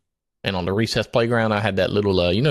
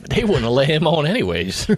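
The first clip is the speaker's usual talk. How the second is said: ordinary talk, nothing marked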